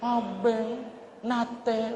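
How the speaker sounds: a sweet mock scold from a Kelantanese wife; mild, tender mock anger; soft angry wife. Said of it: "Kalau marah pun beres".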